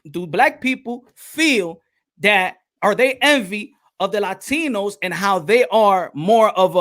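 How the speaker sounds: slow, careful reading of the question; measured, slow re-ask; slow deliberate restating. Retold or imitated. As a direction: lay out each word so nobody mishears. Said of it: "I said".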